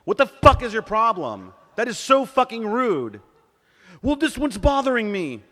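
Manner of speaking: very loud, high-pitched outburst; exasperated shouting, re-enacted at full force; angry yelling. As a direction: lose your temper and yell. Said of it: "Why'd I yell at him?".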